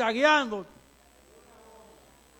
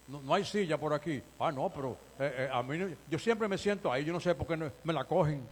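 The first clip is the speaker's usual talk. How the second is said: much softer, lower, whiny complaining; a hint of a petty churchgoer; 9 semitones lower, 8 dB quieter than usual. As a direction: grumble like someone whose seat was taken. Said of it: "Y le, y".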